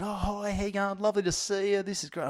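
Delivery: high, soft, gushing mock greeting; imitating the organiser. Organiser welcoming the comedian, high and gushing. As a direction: put on her sweet, high welcome. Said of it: "she comes up and said".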